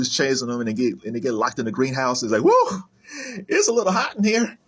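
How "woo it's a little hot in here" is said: high, put-on snowman voice; whoop, then voice the snowman up high; imitated cartoon snowman. Snowman locked in a greenhouse, mock overheated. Voicing Frosty trapped in the greenhouse.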